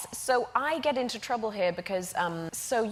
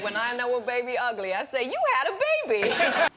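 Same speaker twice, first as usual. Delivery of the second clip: put-on Southern farm accent; 4 semitones higher than usual. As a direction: imitate a no-nonsense Southern nurse. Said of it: "the farm accent sounds a little bit Midwest and Southern".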